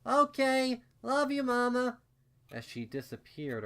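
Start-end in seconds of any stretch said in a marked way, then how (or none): 0.1-2.0 put-on high, loud child's call from afar; little filly calling. A narrator's reading. Filly calling goodbye to her mama, high and loud. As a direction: call it back as you run off. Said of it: "I heard her call out".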